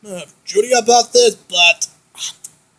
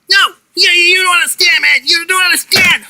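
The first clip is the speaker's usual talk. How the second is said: desperate pleading; 6 semitones higher than usual. Acted. Desperate pleading, high and loud.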